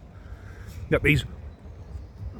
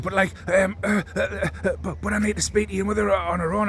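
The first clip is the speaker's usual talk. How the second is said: higher, halting mock of a flustered woman; stammering assistant mocked; 5 semitones higher than usual. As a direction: stutter through it like she's lost for words. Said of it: "And then she said".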